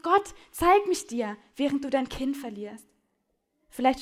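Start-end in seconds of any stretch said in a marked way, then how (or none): 0.0-1.4 slow, loud, higher plea; desperate slow call to God; cry to God. Retold or imitated. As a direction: cry it out slowly, begging. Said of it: "Vielleicht schreist du diesen Satz".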